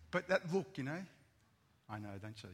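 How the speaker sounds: ordinary talk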